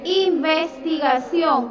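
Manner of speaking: Investigación said slowly and clearly, syllable by syllable; slow dictation. Shown as a demonstration. Teacher answering a pupil, dictating slowly. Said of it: "¿cuál es la, la segunda?".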